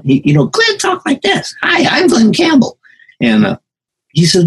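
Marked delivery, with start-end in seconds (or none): 0.5-2.8 hammed-up falsetto impression of the singer. Musician mimicking the singer's voice on a film set, high-pitched impression. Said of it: "he tells me to bring my voice down".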